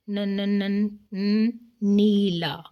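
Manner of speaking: repeated ن sounds, then slow نیلا; sounding out the first letter. Shown as a demonstration. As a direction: tap out ن ن ن before نیلا. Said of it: "ن سے شروع کیا". (ن کی آواز دہرا کر نیلا)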